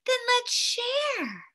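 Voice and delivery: lightly voiced little girl, high and slow. A narrator's reading. Little girl offering to share with the snowman, lightly childlike. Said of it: "a little girl said".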